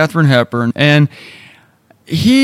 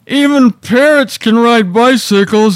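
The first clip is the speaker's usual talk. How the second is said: mock despair; 9 semitones higher, slower than usual. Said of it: "I said to Melody".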